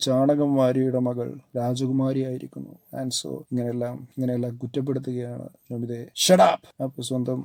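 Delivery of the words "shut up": sharp, loud, high yell. Acted. Nomita yelling at Ajit after the insult, shouting. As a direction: cut him off with a shout. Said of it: "Nomita yells".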